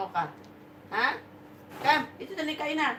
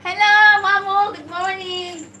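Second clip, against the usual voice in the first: high, loud sing-out hello; calling out a greeting; 9 semitones higher, 14 dB louder than usual. Real, not acted. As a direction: call good morning high and loud. (mataas at malakas na pagbati)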